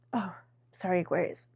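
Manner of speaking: very soft, tearful apology; overcome, voice dropping away; crying. Real, not acted. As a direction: quietly apologize through real tears. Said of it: "oh gosh, I am crying".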